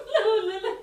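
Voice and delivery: high burst of laughter; giggling denial; laughing. Real, not acted.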